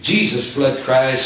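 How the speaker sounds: normal talking voice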